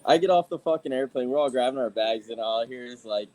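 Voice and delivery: ordinary talk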